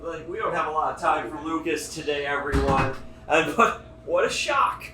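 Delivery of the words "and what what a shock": mock shock. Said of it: "staged reveal, faked shock".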